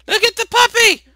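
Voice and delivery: very high, loud squeal; excited urging. Real, not acted. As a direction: squeal it quickly to grab attention. Urging character to notice puppy.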